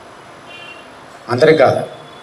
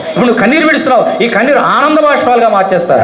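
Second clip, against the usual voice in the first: higher, bright promise with a laugh; voicing God; 6 semitones higher than usual. Preacher speaking as God to those who weep, raised and joyful. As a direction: speak as God turning tears to joy. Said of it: "అంటున్నాడు దేవుడు".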